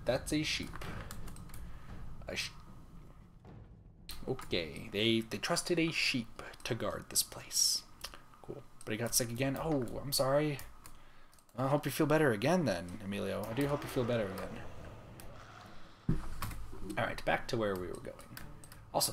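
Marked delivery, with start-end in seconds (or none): none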